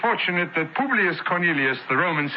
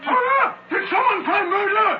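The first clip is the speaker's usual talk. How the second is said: much higher startled exclamation; alarmed arrival. Acted. Centurion arriving at the scene calls out alarmed. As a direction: burst in alarmed.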